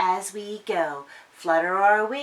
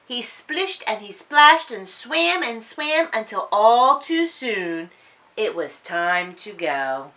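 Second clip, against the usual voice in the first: ordinary delivery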